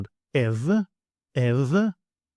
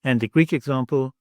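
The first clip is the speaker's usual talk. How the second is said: ordinary talk, nothing marked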